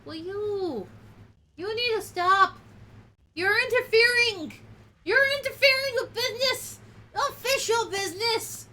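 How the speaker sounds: high, loud mock-official scolding; mock lecture. Real, not acted. Owner lecturing a meddling pet about official business, loud and mock stern. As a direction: lecture the pet as if it broke the law.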